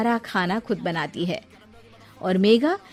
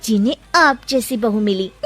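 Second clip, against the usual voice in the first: taunting; 4 semitones higher than usual. Acted. Daughter-in-law taunts.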